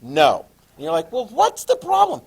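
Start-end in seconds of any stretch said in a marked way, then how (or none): 1.1-2.2 much higher, louder student voice; high mock puzzlement. Teacher voicing students asking about problems, high and loud. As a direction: pipe it up high as a baffled student.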